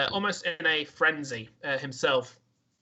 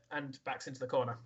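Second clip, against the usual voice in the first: ordinary talk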